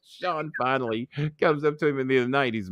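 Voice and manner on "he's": ordinary talk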